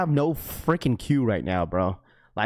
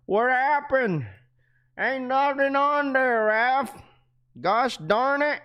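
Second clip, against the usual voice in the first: high, frantic put-on voice for a panicking fan; hammed-up panic, much higher; mock panicked viewer; 11 semitones higher, slower than usual. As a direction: squeak it out like someone losing their mind. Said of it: "Everyone starts to panic".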